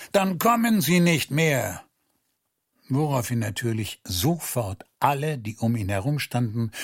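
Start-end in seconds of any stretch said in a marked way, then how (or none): 0.1-1.8 lauter, höher gebrüllter Befehl. Acted. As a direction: schroff und laut abweisen. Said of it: "brüllte er sie an". (er brüllt die Bittsteller an, laut und abweisend)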